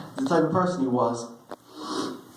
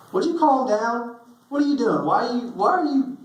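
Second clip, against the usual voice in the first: put-on exasperated older brother; 10 semitones higher than usual. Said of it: "he ran into me and he said".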